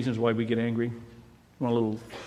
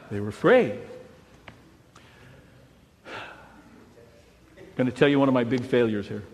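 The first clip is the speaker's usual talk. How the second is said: usual speaking voice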